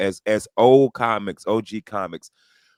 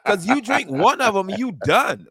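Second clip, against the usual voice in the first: laughing; 11 semitones higher, 3 dB louder than usual. Real, not acted. Laughing through joke.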